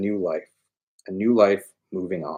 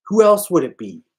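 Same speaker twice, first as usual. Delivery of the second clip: high, loud exasperated; Tony snaps back in disbelief, angry; angry retort; 12 semitones higher, 9 dB louder than usual. Acted. Loud exasperated demand.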